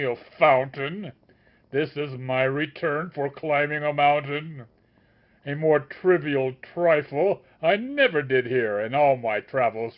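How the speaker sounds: loud, scornful master's rebuke; shouting upbraid. A narrator's reading. The master shouts his scorn at the sage. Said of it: "shouts out his upbraid".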